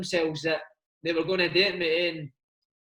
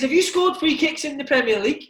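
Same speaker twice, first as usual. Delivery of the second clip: challenging manager; 6 semitones higher, 4 dB louder than usual. Retold or imitated. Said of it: "He says".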